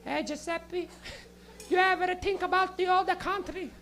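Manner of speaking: put-on high Italian-accented grandma; wistful, hammed-up nonna asking Giuseppe; stoned Italian nonna. Comic as high nonna to Giuseppe, high Italian grandma voice. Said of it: "getting high with a little nonna".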